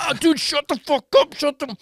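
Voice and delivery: high, fed-up groan of thought; exasperated inner voice. Retold or imitated. Tripping guest thinking at the cop, high and fed up. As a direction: roll your eyes inwardly. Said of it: "I'm going".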